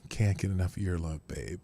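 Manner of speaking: very low, smooth crooner talk. Acted. Host showing off a sexy singer voice, deep impression. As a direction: drop deep for a sultry soul-singer impression. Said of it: "sometimes I can do that".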